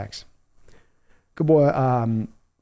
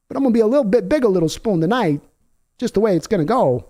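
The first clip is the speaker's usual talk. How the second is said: a raised, whiny football-star voice, lightly put on; mock hurt quarterback; 5 semitones higher than usual. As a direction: play the sulking star quarterback, feeling unloved. Continues quarterback voice.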